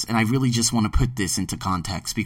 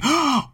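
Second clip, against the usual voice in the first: mock gasp; 13 semitones higher than usual. Mock gasp at big salary.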